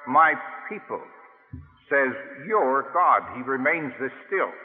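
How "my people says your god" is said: emphatic, drawn-out my people; stress on My people. Shown as a demonstration. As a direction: lean on My people, says your God. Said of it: "emphasizing as well".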